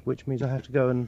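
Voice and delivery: normal talking voice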